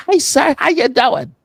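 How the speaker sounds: high, loud mock-English greeting; Englishman accent. Driver greets the cop in a put-on English accent. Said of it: "hits this Englishman accent".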